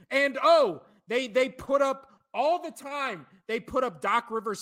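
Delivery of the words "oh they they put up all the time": ordinary delivery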